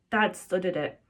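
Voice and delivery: ordinary delivery, nothing marked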